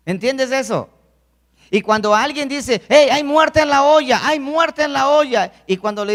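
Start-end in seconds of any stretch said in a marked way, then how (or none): 2.9-5.5 louder and higher, a warning cried out; a member crying out in alarm to the congregation; urgent shout. Retold or imitated. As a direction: call out that there's poison in the pot. Someone cries out a warning.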